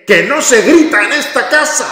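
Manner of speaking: high, loud hammed-up shout; mock parent yell. Parent yelling at kids not to yell, mock shout. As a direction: bellow the rule you are breaking. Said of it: "pero lo dices gritando".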